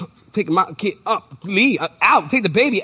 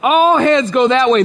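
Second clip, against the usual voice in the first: ordinary delivery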